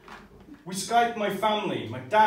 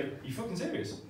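blurted disbelief; 3 semitones higher, 11 dB quieter than usual. Retold or imitated. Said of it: "It was like".

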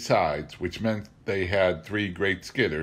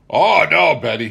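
a hint of a hearty logger's protest, louder; lightly voiced Tom; 8 semitones higher, 12 dB louder than usual. Tom protesting to Betty, lightly voiced and louder. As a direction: brush it off cheerfully. Tom's sheepish reply.

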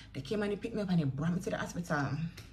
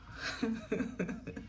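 soft, trailing laugh; 5 semitones higher, 7 dB quieter than usual. Real, not acted. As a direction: laugh quietly to yourself. Laughs while telling her story.

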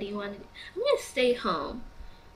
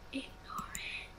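soft guilty whisper; 11 dB quieter, slower than usual. Real, not acted. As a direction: whisper it like a confession. Sheepish whispered choice.

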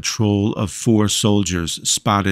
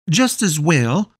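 cheerful mother; 8 semitones higher than usual. A narrator's reading. Voices the mother Gretchen.

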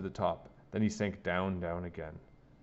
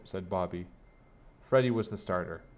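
ordinary delivery

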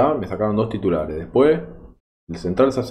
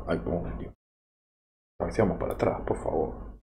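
dismayed whisper; 3 semitones lower, 9 dB quieter than usual. Real, not acted.

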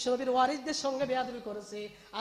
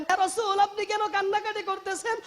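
high, urgent acted plea; distressed question; 6 semitones higher, 4 dB louder than usual. Salama asking the weeping Prophet in her dream, distressed question. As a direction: ask in raised, anguished preaching voice.

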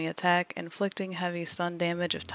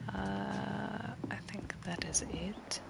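whispering; 9 dB quieter, much slower than usual. Real, not acted. Deciding on skill quietly.

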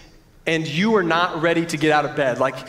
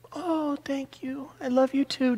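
sweet wife voice; 7 semitones higher, 8 dB quieter than usual. Retold or imitated. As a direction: imitate the sleepy sweet wife. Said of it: "Just sweet as ever".

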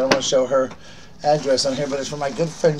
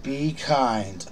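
ordinary delivery

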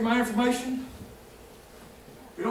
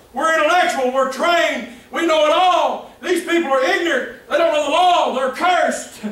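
louder, higher mock superiority; smug elitist boast; 6 semitones higher, 7 dB louder than usual. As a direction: sneer down at the common folk. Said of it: "The religious leaders".

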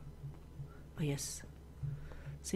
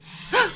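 sudden frightened intake of breath; sharp startled gasp, high; 4 dB louder than usual. Real, not acted. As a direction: jolt of a gasp. Startled by monster.

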